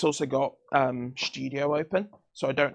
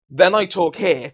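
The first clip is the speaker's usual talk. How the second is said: louder and slower demo; 4 semitones higher, 9 dB louder, slower than usual. Shown as a demonstration. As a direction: push the voice up a level. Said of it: "I need to remember that".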